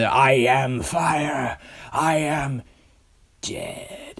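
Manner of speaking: slow, out of breath, the last word fading to a breathless whisper; a dragon gasping out its final chant as life leaves it; dying gasp. Retold or imitated. Reviewer voicing Smaug gasping his last words as he dies. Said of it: "right as he's about to say death, he actually dies".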